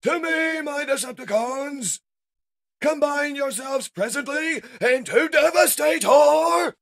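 very high, loud theatrical summons; villain's battle cry. Acted. Shockwave summons Decepticons to combine, shrieking command. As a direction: scream the order to the troops.

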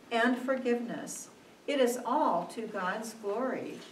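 usual speaking voice

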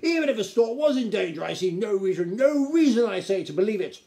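loud, high, indignant protest; snapping duke. A narrator's reading. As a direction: scoff at the whole idea. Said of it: "snapped the Duc de Haberdashery".

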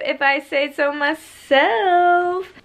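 mock smug. Real, not acted. Proud of packing her suitcase.

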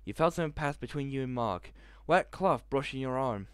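ordinary delivery

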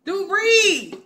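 usual speaking voice

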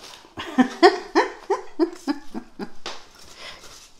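high, breathy burst of laughter; laughing at the thought of keeping the snail; real laugh. Real, not acted. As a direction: laugh at your own joke. Jokes about keeping the snail.

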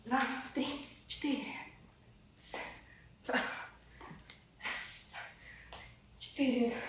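quiet count fading into a whisper; counting workout reps. Real, not acted. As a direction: count reps under your breath, trailing off. Counting reps mid-exercise.

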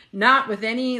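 ordinary talk

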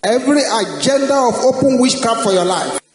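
loud, fast command prayer; preaching at full force. Acted. Preacher rebuking witchcraft over the congregation, shouted. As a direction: thunder the prayer over the crowd.

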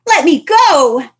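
loud, slow cry to be let go; scream. Acted. Trapper grabs Mitzi, she screams to be released. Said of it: "she heard a scream followed by".

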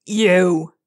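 accusing call in a villain's voice; angry villain. A narrator's reading. Thunderhorn shaking a fist at Rainbow, angry villain.

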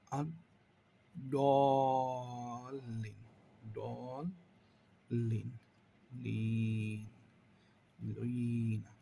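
slow Quranic chant; drawn-out recitation. Shown as a demonstration. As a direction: stretch the word as in recitation. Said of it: "teacher demonstrates recitation". (ধীর তিলাওয়াতের সুর)

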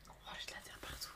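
murmure sans voix, très bas; chuchoté. Real, not acted. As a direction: se le dit tout bas en voyant ses mains. Hands covered in clay. (chuchotement pour soi, mains pleines de terre)

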